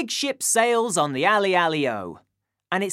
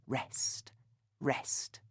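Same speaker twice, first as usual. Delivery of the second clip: whispered rest, barely voiced; 7 semitones lower, 15 dB quieter than usual. Shown as a demonstration. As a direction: breathe the word out softly. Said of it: "rests are gaps or pauses".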